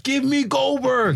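high, slow, laughing mock promo; hammed-up demand, drawn out high; wrestling promo. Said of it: "he's like".

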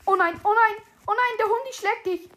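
high, loud, repeated exclamation; alarmed outburst. Real, not acted. Player sees the dog attack friend, alarmed. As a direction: cry out as things go wrong.

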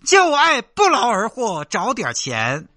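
slow sing-song loudspeaker hawker chant; mocking recorded pitch for a fake beggar; street vendor recording. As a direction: drone it out like a looped market loudspeaker ad. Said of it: "录一个那种卖吼吼那种音频".

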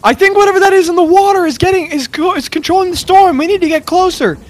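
high, loud shout over a storm; full-voiced, high-pitched yelling; urgent yell. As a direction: call it out urgently to the whole crew. As you turn around on the mast and go.

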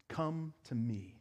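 soft, slow, gentle invitation; a gentle voicing of Jesus calling; tender invitation. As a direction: draw the listener in quietly, slowly.

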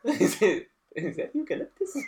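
high giggly parody of eucalyptus; laughing mock question. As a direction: tease by parroting the sniff, giggling. Mocking friend's sniffing.

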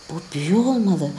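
plain reading voice

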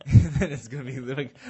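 laughing loudly through the words; loud laugh breaking in. Real, not acted. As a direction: burst out laughing as you say it. Laughing at own joke.

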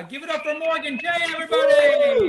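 high, loud shout building to a peak; hyping up the crowd. Real, not acted. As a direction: shout it out to rally applause. Host calls for applause.

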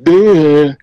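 a mock dumb "duh". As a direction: imitate a dopey grunt. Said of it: "that's not what I sound like".

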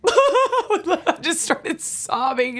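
laughing at her own tears. Real, not acted. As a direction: giggle while admitting it. Laughing while retelling her tears.

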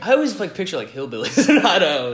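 loud, high, breaking into laughter; laughing tease. Real, not acted. Host ribs his friend about Idaho, laughing loudly. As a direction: tease with a laugh bursting through.

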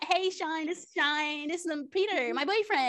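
high, reluctant mock Emma; mock Emma introduction. Imitating Emma introducing her boyfriend, high and reluctant. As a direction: hesitate before boyfriend. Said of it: "this is my".

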